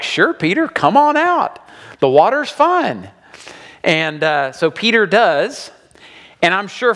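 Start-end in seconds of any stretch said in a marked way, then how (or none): 0.0-3.1 high, breezy invitation to come out; inviting Jesus. Retold or imitated. Jesus inviting Peter onto the lake, breezy and high. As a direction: call him out onto the water, much higher. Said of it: "And Jesus is like".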